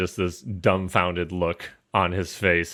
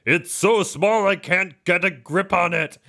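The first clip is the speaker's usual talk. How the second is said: frustrated giant; 10 semitones higher than usual. Acted. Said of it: "He's like".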